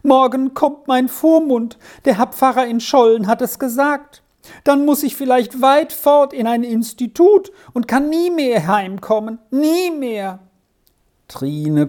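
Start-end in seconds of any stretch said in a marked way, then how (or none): 0.0-10.4 put-on high-pitched, distressed boy's voice; acted childlike despair, without real sobs; tearful lament. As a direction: pour out the dread in a high plaint. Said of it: "rief Arthur weinend aus".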